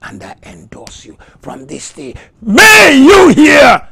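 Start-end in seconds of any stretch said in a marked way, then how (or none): 2.4-3.9 huge, full-voiced preacher's shout; shouted blessing. Real, not acted. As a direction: cry the blessing out at full force. Shouted blessing over congregation.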